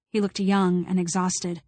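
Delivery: usual speaking voice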